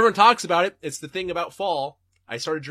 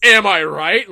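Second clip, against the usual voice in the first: mocking hack comic; 4 semitones higher, 12 dB louder than usual. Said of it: "you're like a bad Jerry Seinfeld stand-up".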